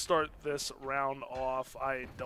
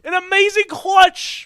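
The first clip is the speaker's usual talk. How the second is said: excited shout; 17 dB louder, slower than usual. Real, not acted. Caster hypes the clutch.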